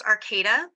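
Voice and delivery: Arcata said as "ar-KAY-duh"; long "ay" in the stressed middle of Arcata; correct local pronunciation. Shown as a demonstration. Guest correcting the host on her hometown, Arcata as ar-KAY-duh. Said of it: "It's actually pronounced".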